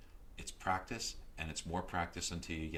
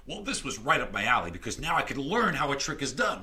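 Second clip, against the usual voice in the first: ordinary delivery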